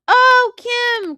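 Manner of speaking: high, loud burst of surprise; thrilled that a friend is calling; real delight. Real, not acted. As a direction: squeal the friend's name. Friend calls during the stream.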